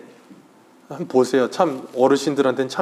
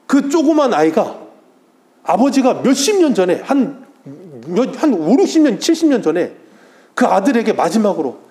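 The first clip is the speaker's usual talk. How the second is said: moved preaching; 6 semitones higher than usual. Real, not acted. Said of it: "막 눈물이 날 것 같더라고".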